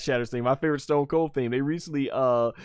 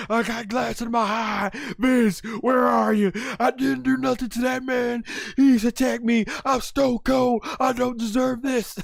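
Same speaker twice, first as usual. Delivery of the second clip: high, loud panicked shout in a put-on voice; yelling about glass in his eye; Stone Cold shouting; 12 semitones higher, 4 dB louder than usual. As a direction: wail it like a wronged tough guy. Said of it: "he's like".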